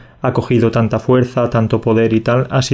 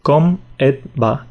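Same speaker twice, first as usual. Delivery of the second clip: "Com et va?" drawn out slowly; slow demo; 2 semitones higher, slower than usual. Shown as a demonstration. Teacher slows "Com et va?" down for the learner. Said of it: "Sí, pues".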